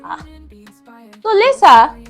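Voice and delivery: ordinary delivery, nothing marked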